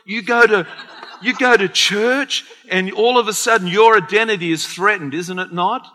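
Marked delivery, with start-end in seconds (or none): 0.0-2.4 sing-song mocking disbelief, raised; mock scoff. Friends scoffing at a churchgoer, sing-song and raised. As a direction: tease like a scornful friend. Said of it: "they look at you".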